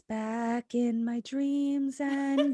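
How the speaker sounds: high, very slow eerie monotone; catatonic creep voice. Retold or imitated. Catatonic Merritt warning her dad, slow creepy drone. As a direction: intone it like someone in a trance, stretched out. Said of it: "from her catatonic creep state".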